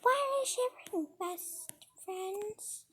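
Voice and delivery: high, hushed creepy friend; Samantha. Retold or imitated. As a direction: ask softly, trailing into an eerie hush. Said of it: "And she's like".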